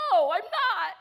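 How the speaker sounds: loud, high wail, imitating a weeping friend; mock crying. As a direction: bawl it in hammed-up despair. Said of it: "she started crying again".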